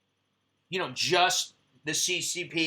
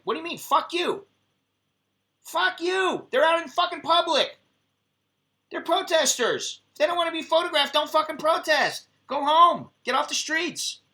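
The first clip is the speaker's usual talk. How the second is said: raised-pitch, indignant outburst; indignant retort; 9 semitones higher than usual. Retold or imitated. Photographer retorting to the man objecting to photos, indignant outburst. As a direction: act out telling someone off, high-pitched.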